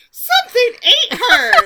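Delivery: high, loud line bursting with laughter; laughing mock horror. Podcaster imitating Ty over the remains, helpless with laughter. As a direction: barely get the mock-horrified quote out for laughing.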